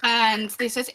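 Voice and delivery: normal talking voice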